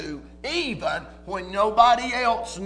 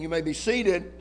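ordinary delivery

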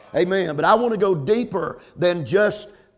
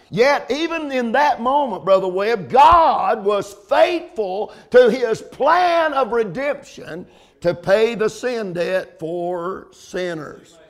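loud, high-pitched preacher's cry; full-force preaching; 4 semitones higher, 5 dB louder than usual. Real, not acted. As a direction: drive it home from the pulpit, stretching key words.

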